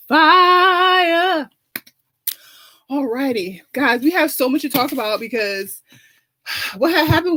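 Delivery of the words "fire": punched high and loud; hyped-up diva finish; excited fire. Acted. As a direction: land the word like a big finale. End of the juice plug.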